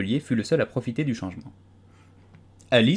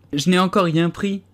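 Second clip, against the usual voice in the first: offended tone; 9 semitones higher, 4 dB louder than usual. A narrator's reading. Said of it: "d'un ton offensé".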